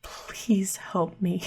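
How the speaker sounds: slow, very soft whisper; whispered plea. Acted. Possessed sister pleading while hugging, whispered plea. Character pleads during a hug.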